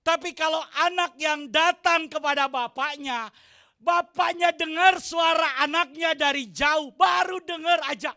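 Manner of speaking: full-force preaching. Acted. Pastor exhorting the congregation with full force. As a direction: preach it out to the church. Preaching about father hearing child.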